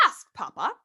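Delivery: ordinary delivery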